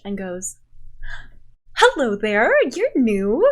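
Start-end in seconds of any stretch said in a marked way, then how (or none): 1.8-3.4 bright loud greeting. Acted. GM voices Hannelore greeting warmly.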